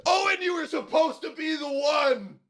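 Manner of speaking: high, shouted movie-villain lament; mock dramatic Jones. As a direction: overdramatic betrayed wail, hammed up. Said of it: "He's like".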